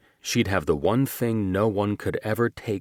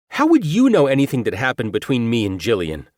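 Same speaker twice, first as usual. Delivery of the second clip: fast, higher, louder rattled question; rattled man's voice; 6 semitones higher, 7 dB louder, faster than usual. Acted. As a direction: rush it out defensively. Antagonist rattled, rushed question.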